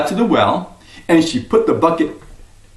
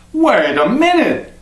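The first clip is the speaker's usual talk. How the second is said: higher, slow startled protest; surprised objection; 6 semitones higher, slower than usual. Acted. Superman's wife objects to the woman, startled and higher. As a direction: stop her in disbelief.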